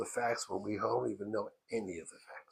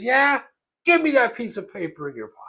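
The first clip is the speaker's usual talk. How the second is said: loud, high, disbelieving order; angry demand; 10 semitones higher, 14 dB louder than usual. Retold or imitated. Rebbe demanding the hidden paper, angry. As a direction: demand it forcefully and fast.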